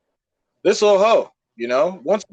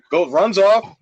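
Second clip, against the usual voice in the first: ordinary talk, nothing marked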